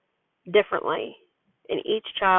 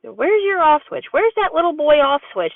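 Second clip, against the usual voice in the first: playful tickling tease; 7 semitones higher than usual. Retold or imitated. Mom tickling her son in bed, playful higher tease. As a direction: tease a giggling child while tickling. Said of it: "and I said".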